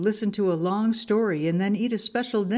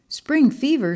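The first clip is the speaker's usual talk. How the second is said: higher, louder storybook surprise; startled question; 8 semitones higher, 6 dB louder than usual. A narrator's reading. Monkey alarmed by his friend's diagnosis, startled.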